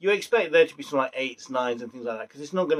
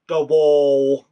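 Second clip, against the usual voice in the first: Danny Dyer impression; 8 dB louder than usual. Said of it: "Danny Dyer walking around going".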